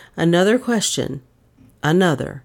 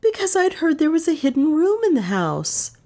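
put-on higher young woman's voice; Dale's admission; 11 semitones higher than usual. Dale confessing to the detective, pitched higher. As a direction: give up the truth.

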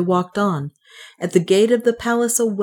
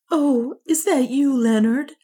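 high, trembling relief; barely holding back fear; voice broken with fear; 8 semitones higher than usual. A narrator's reading. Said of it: "in a voice broken with fear".

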